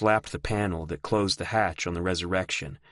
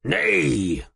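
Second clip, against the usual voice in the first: bellow; 5 semitones higher, 4 dB louder than usual. A narrator's reading. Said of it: "Rudwick bellowed".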